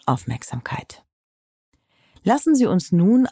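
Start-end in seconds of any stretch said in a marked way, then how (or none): 0.1-1.0 whispering. Acted. Calming self-help narration.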